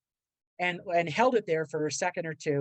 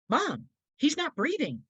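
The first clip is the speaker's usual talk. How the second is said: higher, slow, alarmed cry to mom; urgent plea; 9 semitones higher, slower than usual. Retold or imitated. Son begging his mother to help his unconscious dad, urgent and high. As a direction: plead with her, he's not breathing.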